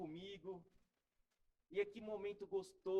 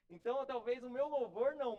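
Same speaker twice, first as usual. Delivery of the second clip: louder, self-mocking punch; emphatic joking aside; 5 semitones higher, 8 dB louder than usual. Real, not acted. Pastor jokes he is off-key, louder emphatic aside. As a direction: play up the joke about his own voice.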